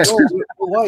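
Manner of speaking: high, loud whiny Karen voice; indignant Karen insisting she belongs; mock Becky. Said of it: "Becky's like".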